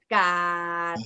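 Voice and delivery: "gad" in a low, level tone. Shown as a demonstration. Teacher demonstrates a Thai word to students, low tone. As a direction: say "gad" held low and even. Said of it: "low tone".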